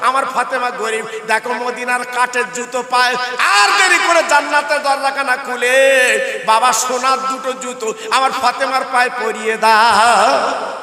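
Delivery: loud chanted sermon with long drawn line endings; impassioned religious storyteller; full-force preaching. Acted. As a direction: preach it out, ringing and sing-song.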